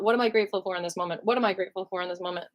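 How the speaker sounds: fast, pressed repeated mantra; rushed gratitude mantra. Retold or imitated. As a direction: squeeze the words out quickly over and over. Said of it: "squeezing out".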